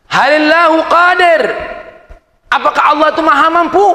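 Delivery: bertanya tinggi dan lantang; suara si pemabuk. Retold or imitated. Si pemabuk bertanya pada syekh. (pemabuk bertanya keras)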